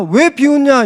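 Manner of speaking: high, loud retold scolding from the angel; scolding rebuke. Angel rebuking laughing Sarah, a high loud scold. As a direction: snap it at her like a stern angel. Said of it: "꾸짖은 거예요".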